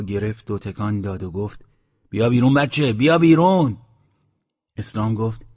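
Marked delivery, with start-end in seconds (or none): 2.1-3.8 loud holler, climbing on the repeat; calling out. A narrator's reading. Headman waves the lantern and calls, calling out. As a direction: call the child out insistently.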